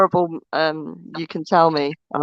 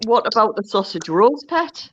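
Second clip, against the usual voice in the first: put-on Sunderland accent; 2 semitones higher than usual. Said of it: "Simple's Meerkat said".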